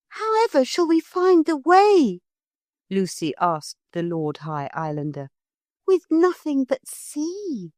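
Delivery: put-on high, worried girl; a little girl wondering; Lucy's voice. A narrator's reading. Lucy asks the Lord High Islander how to find the way, high.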